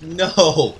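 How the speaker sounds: high, drawn-out mock wail; dismayed cry. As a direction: wail it like a panicking kid. Retold dismay, berry pushed further.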